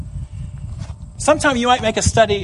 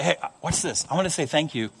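softer conspiratorial aside; father whispering a trick to his little kids, hushed; 3 semitones lower, 8 dB quieter than usual. Retold or imitated. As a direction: let the kids in on a secret. Father confiding to small kids.

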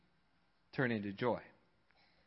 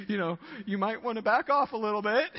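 higher, polite imitated caution; moderate mild warning; 6 semitones higher, faster than usual. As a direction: gently suggest easing off, a little nervously. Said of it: "he's very moderate".

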